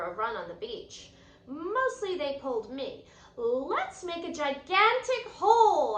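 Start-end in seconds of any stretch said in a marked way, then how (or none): 3.4-6.0 high and loud, bursting with glee; excited gasp. Acted. Girl to her dogs on the beach, excited and loud. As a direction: blurt it out thrilled. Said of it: "I gasped".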